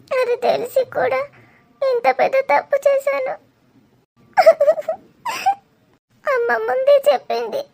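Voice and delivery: crying, breaking voice, pleading with an absent lover; tearful lament. Acted. Abandoned woman begs her lover Dheeru not to leave, crying. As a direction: let the sobs break through the words.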